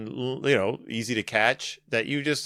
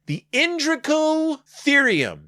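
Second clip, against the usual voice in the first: much higher, slow grand announcement of the Indricotherium; grand announcer; 12 semitones higher, slower than usual. Shown as a demonstration. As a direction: boom out Indricotherium like a big reveal. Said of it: "a very big name".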